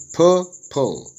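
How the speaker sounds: "purple" split as pur, pul; syllable by syllable. Shown as a demonstration. Teacher dividing a worksheet word, "purple" broken into syllables. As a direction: say "purple" in two separated syllables. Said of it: "Syllable division".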